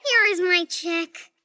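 ordinary delivery

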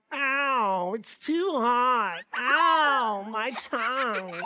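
drawn-out mock wail of pain; hammed-up little bear's howl; hurt Baby Bear. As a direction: whine slowly over a burnt tongue. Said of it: "Baby Bear eats some porridge".

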